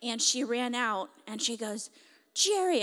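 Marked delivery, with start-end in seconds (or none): 2.3-2.8 put-on high, loud child's call; excited call. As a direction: cry the name out like an eager little girl. Girl runs out calling to him.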